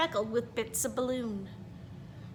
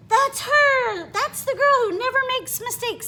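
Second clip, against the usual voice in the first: much higher, louder thrilled shout; excited fan; 10 semitones higher, 7 dB louder than usual. Acted. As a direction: squeal at spotting her. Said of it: "said the woman".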